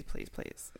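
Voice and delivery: whispered pleading; whispering. Shown as a demonstration. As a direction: breathe the pleas without voice. Pleading, then repeated whispered.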